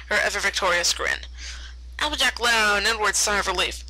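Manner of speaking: ordinary delivery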